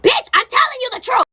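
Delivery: high-pitched mock shout; acted exasperated yell; exasperated insistence. Insisting to disbelieving friend.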